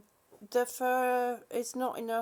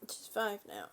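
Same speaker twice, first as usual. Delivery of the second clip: whispered repeat, softer; 4 semitones lower, 5 dB quieter than usual. Shown as a demonstration. Owner repeats the dog's age, whispering. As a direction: breathe it out as a whisper. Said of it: "same words, whispered".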